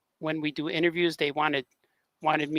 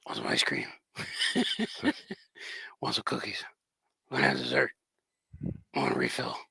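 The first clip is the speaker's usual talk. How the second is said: low grunting mumble, lower and softer; Daryl impression; 4 semitones lower, 7 dB quieter than usual. Teasing his wife by asking in a low Daryl mumble. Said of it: "whenever I watch that with my wife".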